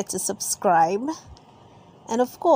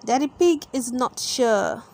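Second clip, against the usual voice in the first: ordinary delivery, nothing marked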